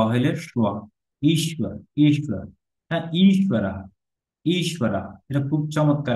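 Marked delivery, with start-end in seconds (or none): 1.2-2.4 ঈশ্বর as "eesh-wa", w in place of b. Shown as a demonstration. Teacher explaining the letter to learners, ঈশ্বর with w. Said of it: "বটাকে আমরা ওয়ার মতো". 3.2-5.0 ঈশ্বরা as "eesh-wa-ra", long first vowel. Shown as a demonstration. Teacher explaining the letter to learners, ঈশ্বরা with w. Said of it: "বটাকে আমরা ওয়ার মতো".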